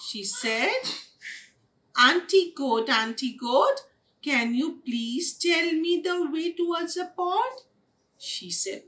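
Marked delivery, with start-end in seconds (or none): 2.0-7.6 high, lilting sweet plea; polite request. A narrator's reading. Kitten asks Auntie Goat the way, polite request. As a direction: ask politely in a raised singsong voice. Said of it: "she did not ask politely".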